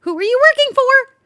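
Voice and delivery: high, loud interrogating call; demanding shout. Real, not acted. Demands of the hidden spy.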